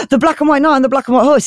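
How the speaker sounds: high, fast mock amazement; hammed-up awe, rattled off quickly; astonished king. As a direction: gasp it out like an awestruck king.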